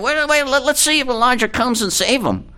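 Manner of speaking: high-pitched mock excitement. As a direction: jeer it in a high voice, making fun of him. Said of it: "they're mocking him, they're making fun of him".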